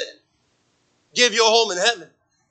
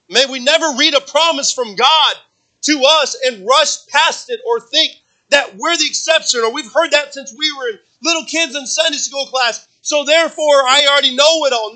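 louder, higher, urgent preaching push; rising pulpit fervor; full-force preaching; 7 semitones higher, 5 dB louder than usual. Acted. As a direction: drive it home to the congregation.

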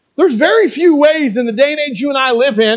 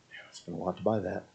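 much lower, much softer, quick grumble; grudging gift giver; 19 dB quieter, faster than usual. Retold or imitated. Gift giver grumbling about the cost, low and quick. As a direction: mutter it like a stingy giver.

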